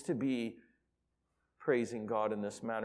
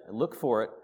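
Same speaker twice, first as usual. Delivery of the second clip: ordinary delivery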